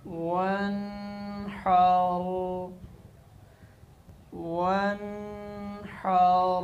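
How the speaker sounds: वन हार drawn out slowly; waqf form. Shown as a demonstration. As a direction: recite वन हार in pause. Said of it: "वक्फ".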